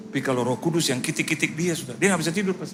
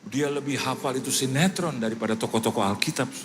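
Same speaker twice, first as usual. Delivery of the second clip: ordinary talk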